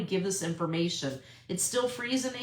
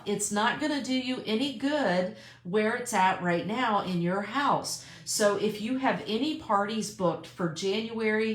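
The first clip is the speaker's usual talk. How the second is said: ordinary talk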